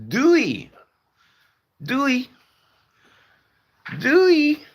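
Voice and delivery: very high, loud falsetto call; excited mock-Dutch greeting. Streamer playfully greets a Dutch viewer, high mock-excited. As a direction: sing out a squeaky, over-the-top goodbye.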